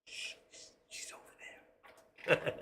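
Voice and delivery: real slow whisper; secretive, voiceless shushing; hushed aside. Real, not acted. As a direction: whisper it so she can't hear. Secretive aside about his wife.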